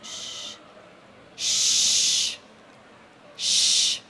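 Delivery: unvoiced shh, shh, shh, steady beat; rhythmic shushing. Shown as a demonstration. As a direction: shush a baby to sleep in pulses. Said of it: "just make sure it's a loud shush".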